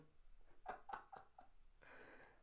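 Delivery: hushed, voiceless snickering; gloating giggle. Retold or imitated. Child proud of saving the world, gloating snicker.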